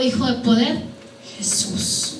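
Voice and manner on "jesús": near-whispered, reverent single word; very soft, breath-only reply; hushed answer. Real, not acted. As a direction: drop to a whisper to answer your own question. Answers his own rhetorical question.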